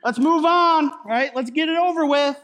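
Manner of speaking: mock impatient God waving off the mourning. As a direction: play God as fed up and eager to move on. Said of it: "God is essentially saying".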